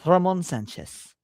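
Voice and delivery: put-on a learner's go at a Spanish accent. Streamer copies co-host's pronunciation, attempted Spanish accent.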